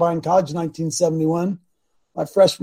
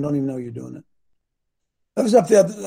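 ordinary delivery

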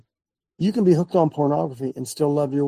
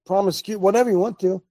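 normal talking voice

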